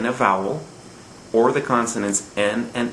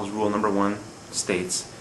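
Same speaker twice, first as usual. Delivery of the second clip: usual speaking voice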